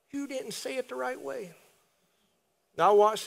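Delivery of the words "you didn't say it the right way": high, soft mocking voice; small, high-pitched nitpicking imitation; mock fault-finder. God as he is not, faulting a prayer, mock nitpicking. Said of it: "He's not like this".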